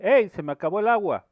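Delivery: loud, high alarmed call. Retold or imitated. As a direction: shout that the water ran out. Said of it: "Este dice". (grito agudo y fuerte de aviso)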